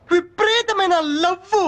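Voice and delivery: dramatic story pitch. Acted. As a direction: stretch it slowly for melodrama. Director dramatizes heroine's love in pitch.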